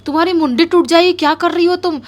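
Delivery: startled man pleading to stop; alarmed scolding. Retold or imitated. Husband shouts at the girl to straighten her neck, alarmed.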